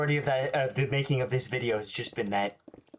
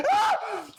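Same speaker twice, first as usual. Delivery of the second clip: sudden high startled shriek; real shriek of fright; jump scared scream; 4 dB louder than usual. Real, not acted.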